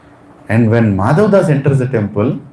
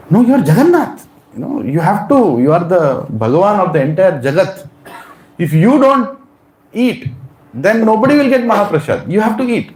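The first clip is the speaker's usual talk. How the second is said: higher, earnest voice of a goddess urging her lord; Lakshmi Devi pleading; 8 semitones higher than usual. Retold or imitated. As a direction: coax him to eat, voice raised high. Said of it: "Lakshmi Devi is going".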